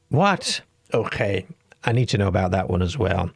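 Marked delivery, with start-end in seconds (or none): 0.1-0.6 sudden high disbelieving what; startled what, much higher; surprised. Real, not acted. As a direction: jump up in pitch in astonishment. Shocked by the alien claim.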